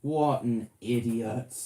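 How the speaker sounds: drawn out exasperated; slow. Real, not acted. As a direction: stretch it with disbelief. Repeats insult slowly.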